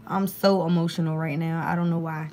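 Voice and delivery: ordinary talk, nothing marked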